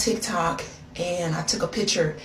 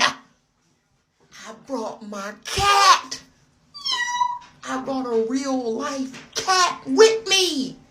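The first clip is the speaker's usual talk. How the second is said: slow; 5 semitones higher, much slower than usual. Real, not acted. Slow emphatic reveal.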